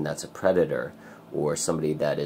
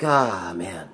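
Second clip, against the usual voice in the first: dismayed groan; 3 semitones higher, 5 dB louder than usual. Retold or imitated. Acts out dismay at the predator.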